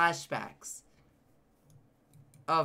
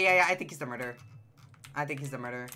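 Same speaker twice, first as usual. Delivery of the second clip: ordinary talk, nothing marked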